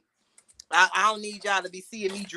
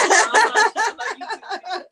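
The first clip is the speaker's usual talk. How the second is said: loud high laugh; 11 semitones higher, 4 dB louder than usual. Real, not acted. Laughing hard at her husband's teasing. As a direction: burst of high cackling laughter.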